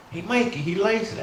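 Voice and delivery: put-on high, excited kid voice; high-pitched commercial kid; kid from cereal ad. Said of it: "General Mills commercial".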